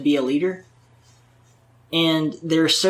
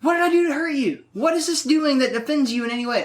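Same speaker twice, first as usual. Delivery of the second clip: high, mocking put-on voice; a squeaky, sarcastic imitation of someone being offended; mock whiny voice; 9 semitones higher than usual. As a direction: play the hurt, fussy colleague. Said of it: "I'm like".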